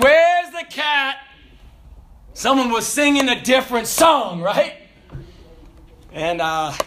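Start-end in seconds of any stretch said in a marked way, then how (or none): 0.0-1.2 higher, slow demanding sergeant; angry sergeant. Retold or imitated. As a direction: call out the culprit louder. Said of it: "he said".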